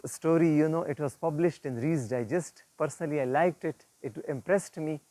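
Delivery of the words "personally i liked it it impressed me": usual speaking voice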